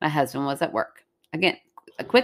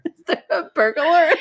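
high echo through laughter; mock the question while cracking up; laughing mimicry; 11 semitones higher, 3 dB louder than usual. Echoing her while cracking up.

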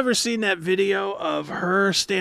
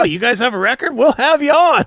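eager radio host; 6 semitones higher, 6 dB louder than usual. Retold or imitated. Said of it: "when we needed those bands".

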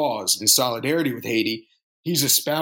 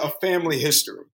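ordinary talk

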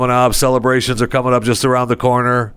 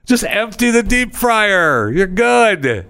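exasperated advice; 10 semitones higher, slower than usual. Retold or imitated. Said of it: "I'm thinking".

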